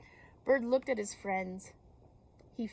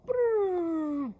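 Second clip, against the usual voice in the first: mock crash noise; 9 semitones higher, 6 dB louder than usual.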